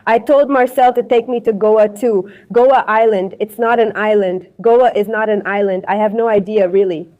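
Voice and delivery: ordinary delivery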